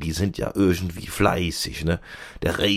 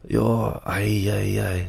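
ordinary talk

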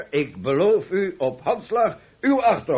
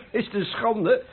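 angry outburst; 9 semitones higher than usual. Acted. Wife begs him to be silent.

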